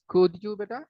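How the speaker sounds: usual speaking voice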